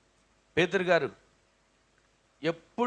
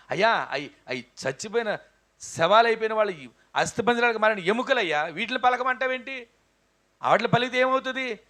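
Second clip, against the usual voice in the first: higher pitched protesting plea; a lightly voiced prophet objecting; doubting Ezekiel; 5 semitones higher, 4 dB louder than usual. Preacher voicing Ezekiel protesting to God about dry bones. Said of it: "అనే అనొచ్చు".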